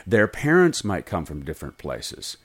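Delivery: ordinary talk, nothing marked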